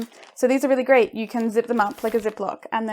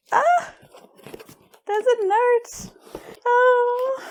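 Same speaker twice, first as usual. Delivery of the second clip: high squealing discovery; surprised delight; 13 semitones higher, 3 dB louder, much slower than usual. Real, not acted. Finding the note, high delighted squeals. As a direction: gasp in delight at the find.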